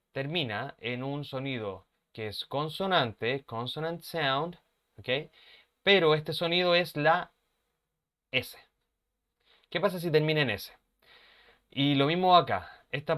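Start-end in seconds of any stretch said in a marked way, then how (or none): none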